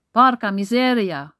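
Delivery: loud furious Italian curse; angry exclamation. Acted. Massimo angrily rejects Michaela's comfort. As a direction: spit the curse out in rage. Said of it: "rief er wütend".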